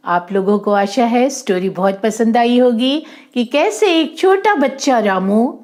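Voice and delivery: plain reading voice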